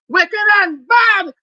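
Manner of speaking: high, slow shouted refrain; furious shout. Real, not acted. Denouncing the haters from the temple line, slow loud shout. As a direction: hammer each word, loud and high. Chant against haters peaks.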